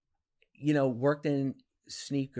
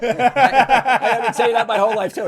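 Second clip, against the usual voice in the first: loud and high, words breaking into laughter; laughing hard; 13 semitones higher, 20 dB louder than usual. Real, not acted. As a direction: laugh out loud at yourself while talking. Laughing at his own catchphrase.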